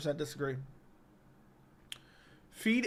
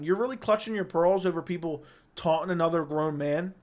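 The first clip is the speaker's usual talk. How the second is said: ordinary delivery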